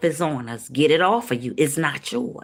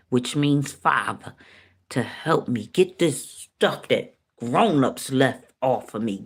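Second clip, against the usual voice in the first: usual speaking voice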